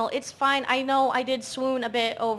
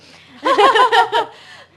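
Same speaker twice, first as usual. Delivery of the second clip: loud laugh; 10 semitones higher, 13 dB louder than usual. Real, not acted. Laughs at her own voice.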